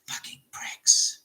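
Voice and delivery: slow, voiceless whisper; imitated whispered curse; man's ghostly voice. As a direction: whisper it like a spirit over someone's shoulder. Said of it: "you hear this man's voice say".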